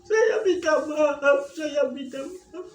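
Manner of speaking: crying apology, slowed; retold crying apology. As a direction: beg forgiveness through tears. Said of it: "Nangis yang sejadi-jadinya".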